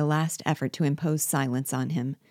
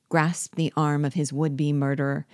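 ordinary delivery, nothing marked

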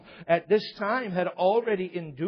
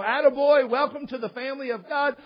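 hammed-up high, hearty welcome; mock-jubilant pat on the back, loud start; congratulating recruiter; 8 semitones higher, 5 dB louder than usual. Recruiter retold congratulating the kid.

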